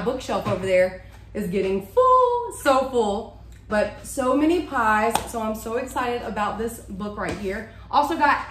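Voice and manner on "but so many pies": ordinary talk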